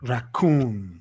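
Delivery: Raccoon with the weight on the final "coon"; stress on second syllable. Shown as a demonstration. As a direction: lean on the "coon" of Raccoon, letting it fall. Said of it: "is on the coon".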